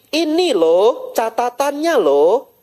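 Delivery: high, insistent boss pointing at the notes; exasperated boss. Retold or imitated. Boss showing the proof. (bos kesal sambil menunjukkan catatan, nada tinggi)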